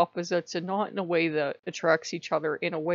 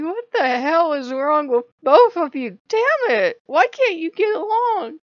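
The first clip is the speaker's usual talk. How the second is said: high, loud fed-up scolding; exasperated scolding; 9 semitones higher, 9 dB louder than usual. Retold or imitated. Baldur scolding the fighting pair, high and loud exasperated. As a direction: scold two squabbling kids. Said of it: "Baldur's just looking on like".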